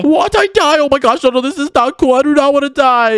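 high-pitched, hammed-up panic; panicked protest. Player told he will die, panicked. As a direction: protest in a high, alarmed yelp.

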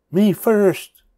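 high loud demanding cry; cried out. A narrator's reading. Piglet cutting the line, shouting loud. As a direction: shout it like a pushy little pig. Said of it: "he cried".

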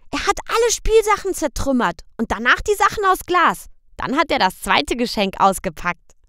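put-on high, loud little boy's question; high child's voice; child asking. A narrator's reading. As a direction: ask papa like a worried small boy. Boy Michel tells.

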